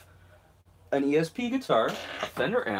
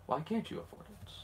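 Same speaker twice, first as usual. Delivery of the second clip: whispering; 4 semitones higher, 9 dB quieter than usual. Pretend. Imagined voice questioning him.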